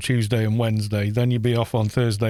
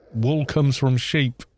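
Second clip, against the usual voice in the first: ordinary talk, nothing marked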